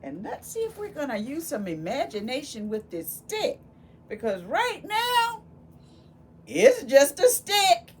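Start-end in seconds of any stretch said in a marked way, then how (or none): 4.1-7.9 high and loud, building suspense; dramatic reveal. Acted. Teacher building suspense for the children, high and loud. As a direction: make the kids lean in on just a stick.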